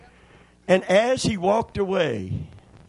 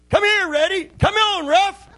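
very high, loud dog call; mynah mimicking its owner; 5 dB louder than usual. Mynah bird rouses the resting dogs, mimicking its owner's high call. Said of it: "He said it in my voice".

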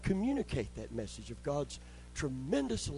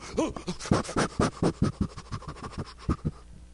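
sharp high gasp of effort under a heavy bench press; straining gasp; 8 semitones higher than usual. Retold or imitated. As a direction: gasp hard as if lifting far too much weight. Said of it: "And I'd go".

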